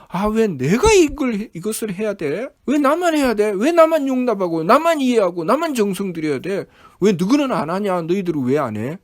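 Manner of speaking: loud, high-pitched mock grumbling; indignant complaint. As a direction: protest like someone unfairly singled out. Acts out someone grumbling at duty.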